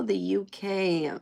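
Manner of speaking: ordinary talk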